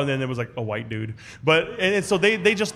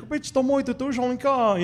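excited aunties; 10 semitones higher, slower than usual. Retold or imitated. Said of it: "And like".

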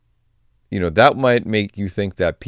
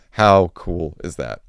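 ordinary delivery

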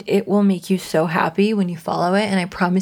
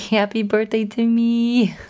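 giggling through a self-cheer; laughing; 3 semitones higher than usual. Real, not acted. Host laughs while wishing herself happy birthday, giggly. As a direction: laugh it out like celebrating yourself.

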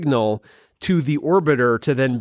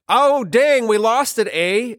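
put-on Canadian accent; 9 semitones higher than usual. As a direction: ham up a Canadian losing a rocket. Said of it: "Canada ... it's like".

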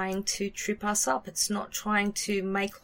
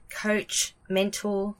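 ordinary delivery

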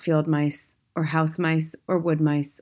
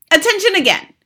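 very high, loud command; shouting; 9 dB louder than usual. A narrator's reading. Grand High Witch calling witches to order, shouting. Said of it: "was shouting".

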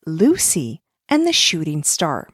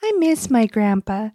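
put-on high child voice; a small girl missing grandpa; little girl voice; 12 semitones higher than usual. Lucy misses grandpa.

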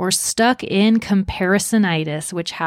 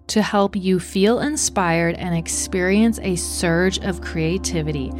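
guided meditation. Acted. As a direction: ease them gently into calm. Leads a creativity meditation.

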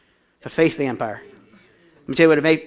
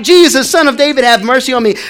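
loud, higher cry for mercy; desperate shout; 7 semitones higher, 10 dB louder than usual. Retold or imitated. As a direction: call out across the crowd. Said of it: "He began to shout".

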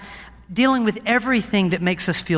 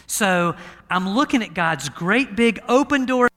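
ordinary talk, nothing marked

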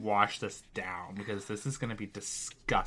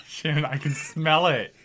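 louder, laughing through the words; laughing loudly; 5 semitones higher, 5 dB louder than usual. Real, not acted. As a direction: laugh it out in disgust. Gagging on gross food.

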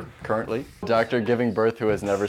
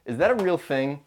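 normal talking voice

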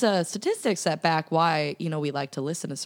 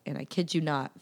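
normal talking voice

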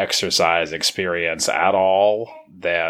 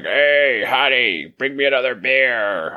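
lazy husband yelling; 6 semitones higher than usual. Retold or imitated. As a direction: ham up a lazy guy bossing his wife from the couch. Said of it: "sitting in another room and be like".

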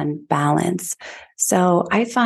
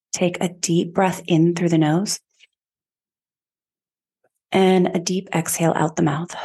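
even, measured meditation voice with long pauses; guided meditation. Acted. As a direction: guide listeners inward, phrase by unhurried phrase. Leads a chakra meditation.

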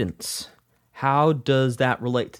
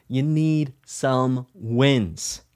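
slow emphatic; much slower than usual. Shown as a demonstration. Repeated slowly for emphasis.

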